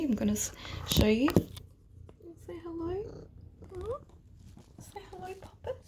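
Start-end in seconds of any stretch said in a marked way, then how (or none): 2.5-4.0 high, soft coaxing pet voice; talking to the cat. Real, not acted. 4.8-5.8 high whispered coaxing; whispering to the cat. Real, not acted. Coaxing her pet cat.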